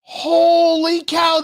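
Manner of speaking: holy cow, drawn out and shouted high; real astonishment, stretching it out; shocked disbelief. Real, not acted. Disbelief at the Schumer video.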